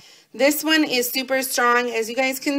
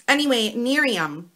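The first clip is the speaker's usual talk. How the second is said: ordinary delivery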